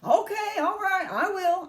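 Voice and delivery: high, loud, hurried giving-in; harried high-pitched assent; flustered husband. A narrator's reading. Flustered husband answers screaming wife.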